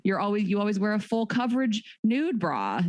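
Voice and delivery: ordinary talk, nothing marked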